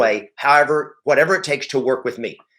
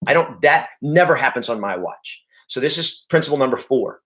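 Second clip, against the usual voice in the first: ordinary delivery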